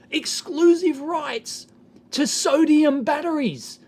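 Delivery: high, drawn-out mock amazement; incredulous scoffing. Real, not acted. Vlogger scoffing at a company's claim, incredulous. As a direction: stretch the claim out in disbelief.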